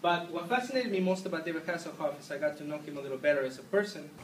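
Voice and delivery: fake Mexican accent. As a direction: speak softly in a put-on Mexican accent. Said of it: "my accent is in fact fake".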